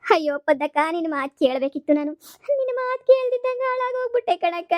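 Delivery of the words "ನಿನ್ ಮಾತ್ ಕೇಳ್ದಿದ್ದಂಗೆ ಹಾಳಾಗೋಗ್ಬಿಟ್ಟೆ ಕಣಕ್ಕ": high, wailing cry of ruin; wailing lament. Acted. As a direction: wail it out to her sister. Beaten wife laments to sister. (ಅಕ್ಕನ ಮುಂದೆ ಎತ್ತರದ ದನಿಯಲ್ಲಿ ಗೋಳಾಟ)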